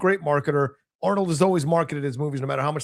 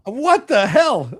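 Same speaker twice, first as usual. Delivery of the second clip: mock outrage; 7 semitones higher, 7 dB louder, much slower than usual. Real, not acted. Reacting to turncoat jab.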